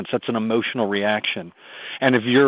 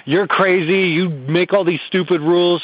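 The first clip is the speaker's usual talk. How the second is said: put-on higher whiny teen voice; mock teen complaint; 5 semitones higher than usual. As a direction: imitate a sulky teenager. Parent voicing teen's complaint.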